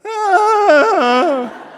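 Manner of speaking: long, high loud shriek; imitated scream back. Boxer looks back at opponent, high mock scream. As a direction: scream back in comic fright. His own answering scream.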